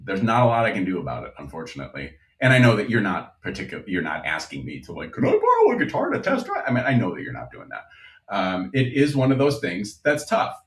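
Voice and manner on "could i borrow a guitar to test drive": much higher, quick imitated customer. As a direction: pitch it way up like a hopeful customer. Imagined customer asks to borrow.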